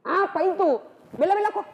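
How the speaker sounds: high, loud demand; demanding shout. Acted. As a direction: insist on seeing it, pitched well up. Umar demands the scripture.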